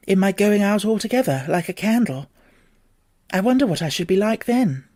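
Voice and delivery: put-on a little girl fretting to herself; nervous young Alice. Alice's worried musing.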